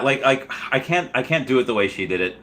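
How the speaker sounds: ordinary delivery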